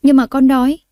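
put-on high, whiny child's complaint; a sulking little girl saying she's hungry; tủi thân, giọng trẻ con nũng nịu; hurt and sulky. A narrator's reading. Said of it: "Tôi tủi thân nói".

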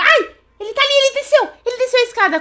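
high startled cry; jumpy alarm. Real, not acted. Sees him coming down.

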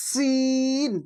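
সিন with a whistling s and long held vowel. Shown as a demonstration. Teacher modelling the letter for learners, whistling s in সিন. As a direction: whistle the s of সিন, then stretch it. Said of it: "একটু সিটি আওয়াজ হবে".

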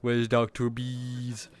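usual speaking voice

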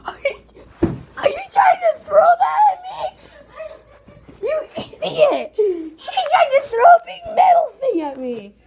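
high, loud yell breaking into laughs; laughing shout. Real, not acted. Kid shouting at sister throwing things, loud and laughing.

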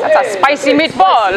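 cartoon Italian-American accent. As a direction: belt it out like an Italian cook. Stock Italian catchphrase impression.

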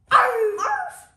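high, loud yapping arfs; loud puppy barks. Pretend. Child playing the evil puppy, barking loudly. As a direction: bark sharply like a little dog. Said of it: "I'm the evil Arf Biscuit puppy".

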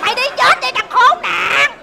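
loud, drawn-out curse at a prank caller; angry cursing. Acted. Café seller curses the caller who wasted her phone credit, angry.

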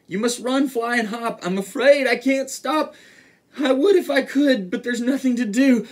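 high, mounting alarm; loud, high, helpless elephant; urgent warning. A narrator's reading. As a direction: warn as if a sneeze is coming. Elephant about to sneeze warns animals.

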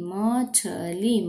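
मछली stretched very slowly; sounding out slowly. Shown as a demonstration. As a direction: draw out मछली syllable by syllable.

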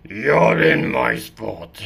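slow low complaining cat; grumpy cat. Acted. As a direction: huff at the intruder slowly. Cat annoyed.

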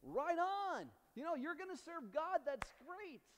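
high-pitched mock congratulation; imagined hearty approval. Joshua supposedly applauding the people's promise, in high, excited approval. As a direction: cheer them on as an imagined Joshua. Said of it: "you would think that Joshua would say".

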